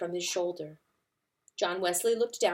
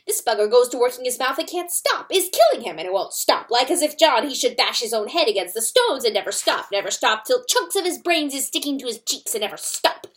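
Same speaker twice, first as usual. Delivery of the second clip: loud, higher furious tirade; outraged weasel; 4 semitones higher, 7 dB louder than usual. A narrator's reading. Weasel raging at the fox's corpse, loud and furious. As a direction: build the fury and hammer it. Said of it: "He was outraged".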